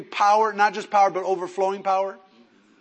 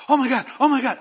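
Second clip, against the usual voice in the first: high, loud mock-panicked cry; imitated panic; 7 semitones higher, 7 dB louder, faster than usual. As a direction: blurt it as if running in alarm. Imitates wife in panicked phone call.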